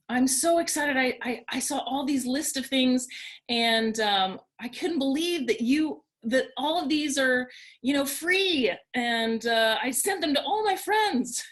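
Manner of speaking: high, bubbly, rushing with delight; excited colleague. Retold or imitated. Colleague gushing about free resources, excited and high. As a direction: gush like a thrilled friend. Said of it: "and she said".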